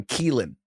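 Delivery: Keelan said with a long ee; mispronounced name. Retold or imitated. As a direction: say Keelan as KEE-lin, the wrong name. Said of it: "Jerry Jones calls him Keelan".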